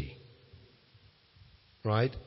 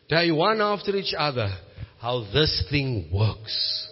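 ordinary delivery

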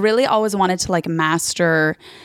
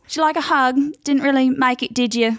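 high-pitched passive-aggressive British put-down; mock sympathy with a tag question; petty British co-host; 7 semitones higher than usual. Co-host to someone who just lost, petty. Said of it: "this bitch is so petty".

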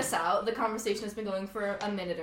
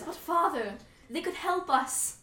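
pleading daughter; 6 semitones higher than usual. Acted. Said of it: "Alora says".

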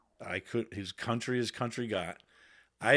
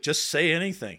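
high excited egging on; eager recruits; 7 semitones higher, 7 dB louder than usual. Retold or imitated. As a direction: urge him to talk, teasing. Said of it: "everybody's like".

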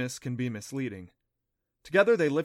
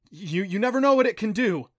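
urgent warning; 8 semitones higher, 9 dB louder than usual. A narrator's reading. Adam still warning her.

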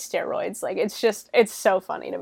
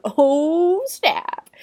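high, loud "oh" falling into a breathy finish; mock-scandalized whoop; hyped-up reaction; 8 semitones higher, 5 dB louder than usual. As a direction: react like an audience to a sick burn. Viewer reacting to a comeback.

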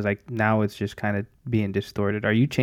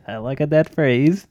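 slow, higher mock Kazakh accent; 4 semitones higher, slower than usual. Host approves of the British phrase, comic Kazakh accent.

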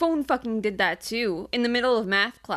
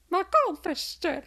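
mock sad Louis; 9 semitones higher, faster than usual. Other speaker imitates Louis.

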